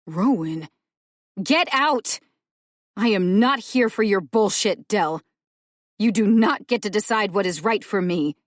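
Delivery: loud, high shout throwing her out; angry yell. Acted. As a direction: roar it, done with her. Dell yelling back at Rowan.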